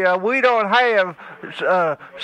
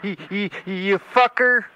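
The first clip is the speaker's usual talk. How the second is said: put-on a crotchety old man who stammers on every word; faster than usual. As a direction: stutter and sputter like an angry old hillbilly. Stuttering insults.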